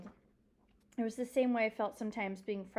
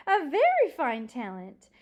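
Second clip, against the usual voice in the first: emphatic praise; 5 semitones higher, 11 dB louder than usual. Acted. Old librarian praising the dog once more, emphatically delighted. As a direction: stretch out "very" with hearty admiration.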